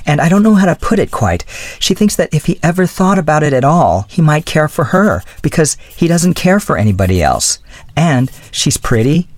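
put-on high girlish voice, earnestly admiring; lightly voiced girl in love; gushing young woman. A narrator's reading.

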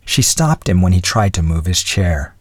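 plain reading voice

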